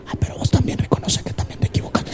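whispered comeback, fast and hushed; whispered retort. Acted. Wife answering husband in bed at night, whispered retort. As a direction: hiss it back without waking anyone.

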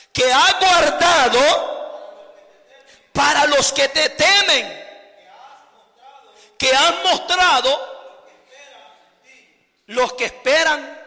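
loud, slow scripture reading; psalm proclaimed. Acted. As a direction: declaim the psalm to the congregation. Reads the psalm aloud. (lectura del salmo, lenta y fuerte)

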